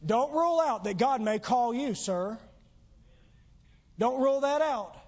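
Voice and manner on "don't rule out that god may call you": normal talking voice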